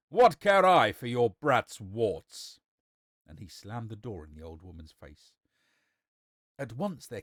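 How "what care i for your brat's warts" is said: loud, slow, scornful shout; angry cry. Acted. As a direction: throw it at her, loud and drawn out. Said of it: "cried the son".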